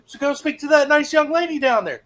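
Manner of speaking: high, loud TI imitation, easing off; mock TI yell. Sister flight TI sends the recruit to the female TI, loud high. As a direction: shout then sweetly send him off. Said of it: "he's like".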